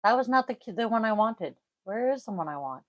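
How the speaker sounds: usual speaking voice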